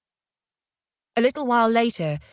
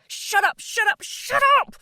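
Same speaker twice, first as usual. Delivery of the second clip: rising frantic yell. Acted. Jay breaking down, yelling at Felicity over and over. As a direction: lose control, climbing into a scream. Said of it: "he yelled at her".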